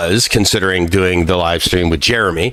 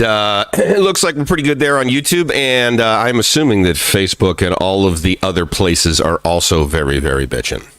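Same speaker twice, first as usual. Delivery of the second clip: ordinary talk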